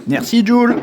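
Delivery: high loud thanks; cheerful shout. Real, not acted. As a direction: call out thanks brightly. Thanking son loudly.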